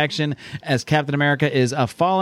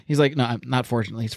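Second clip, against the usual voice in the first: normal talking voice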